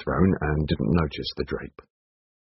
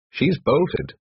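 exclaiming; 8 semitones higher than usual. A narrator's reading. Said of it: "exclaimed Nigel".

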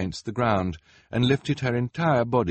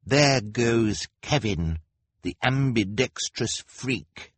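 ordinary delivery

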